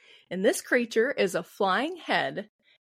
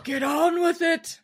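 high shouted quote, mock impatient; hammed-up shouted line; Monty Python shout; 8 semitones higher than usual. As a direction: bellow it like a Python sketch. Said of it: "For any Monty Python fans".

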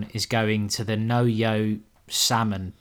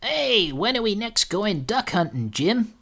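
higher, eager greeting from a festival host; host greeting Jim at the door, higher and eager; excited host; 9 semitones higher than usual. Retold or imitated. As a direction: chirp it up like a chuffed host. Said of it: "do you remember what he says to Jim".

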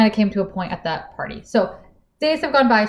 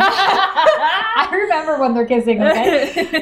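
laughing through the words, pitched up; 7 semitones higher, slower than usual. Real, not acted. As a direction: giggle it out while teasing her friend. Teasing friend over making out.

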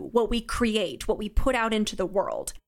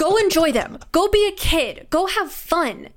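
higher and louder, cheering himself on; cheerful urging; 7 semitones higher, 4 dB louder than usual. Real, not acted. Talking to the host about youth, bright louder urging.

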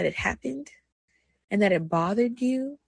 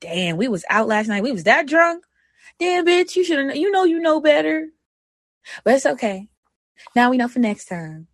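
sassy self-talk; 3 semitones higher, 5 dB louder than usual. Retold or imitated. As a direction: scold yourself like a sassy best friend, then forgive. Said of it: "I be like".

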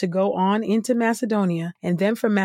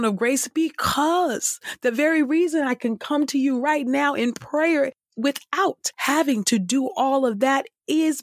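rising fervor; 6 semitones higher than usual. Acted. Fervent climax of prayer.

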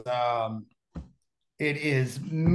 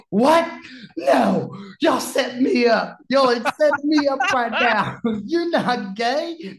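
high, loud stunned outburst; shocked disbelief; 8 semitones higher, 7 dB louder than usual. Retold or imitated. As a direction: squawk it out in total disbelief. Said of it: "He's like".

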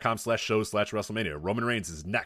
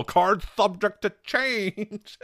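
high, slow mocking whine; mocking dope voice; 11 semitones higher, 5 dB louder, much slower than usual. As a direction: sneer it like a know-it-all fan. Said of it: "a bunch of fucking dopes are gonna get in our mentions and go".

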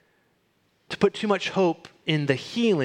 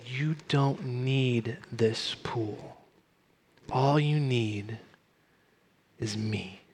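slow, soft, lowered voice; acting a tender, unhurried assurance; gentle reassurance; 3 semitones lower, 5 dB quieter, much slower than usual. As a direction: say it slowly and quietly, as comfort. Jesus reassures the weeping man.

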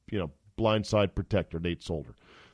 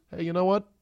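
a higher, breezy imitated coach voice; high mock Belichick; 9 semitones higher than usual. Belichick hinting at a rough year, mock breezy. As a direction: shrug it off as the coach. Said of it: "Bill Belichick might be saying".

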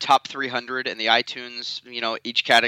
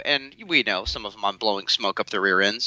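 usual speaking voice

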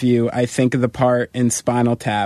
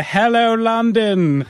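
put-on high shouted German accent; 10 semitones higher, much slower than usual. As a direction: call it out like a German MC. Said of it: "shouting out the names of cities in a German accent".

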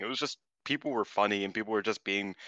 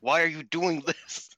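higher-pitched exasperated telling-off; mock scolding; 5 semitones higher than usual. Wrestler to teammate who clapped sarcastically, mock exasperation. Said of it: "Micah was very mad at her".

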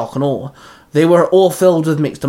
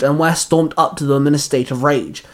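usual speaking voice

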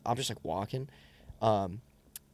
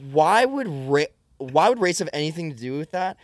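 retold incredulous questioning, raised pitch; higher, louder mock-indignant comeback; indignant rebuttal; 5 semitones higher, 5 dB louder than usual. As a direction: push back, baffled by the accusation.

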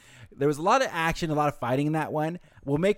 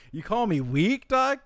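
higher, rising offended challenge; mock offended challenge; 9 semitones higher, 3 dB louder, slower than usual. Kenpachi voiced taunting back, mock offended. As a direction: play Kenpachi bristling at being called weak.

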